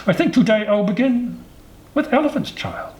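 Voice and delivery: very high crocodile through clenched teeth; between teeth. A narrator's reading. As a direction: jaws clamped shut, squeeze out the words. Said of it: "he said it between teeth like this".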